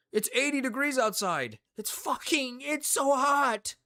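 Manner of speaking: high, whiny mock complaint; mocking whiny Brits. Streamer mocking British complaining about heat, whiny high voice. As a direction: whine it like a spoiled Brit. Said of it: "they were like".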